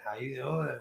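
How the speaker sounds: deep sultry voice; low and sultry greeting. Pretend. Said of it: "That's a good one".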